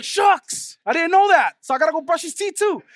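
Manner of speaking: high, loud mock shock; comic surprise. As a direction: play up the realization. Dad's realization, comic.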